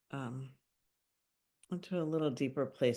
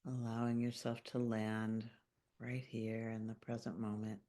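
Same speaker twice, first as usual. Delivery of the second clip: calm meditation voice with long pauses; unhurried, gently guiding listeners into their bodies; guided meditation; 4 semitones lower, 4 dB quieter than usual. Acted. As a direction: lead a grounding visualization, phrase by phrase, leaving long silences. Leads a grounding meditation.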